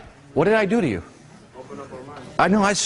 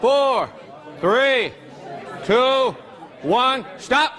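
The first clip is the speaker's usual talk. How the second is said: raised countdown call to the class; calling time; 8 semitones higher, much slower than usual. Real, not acted.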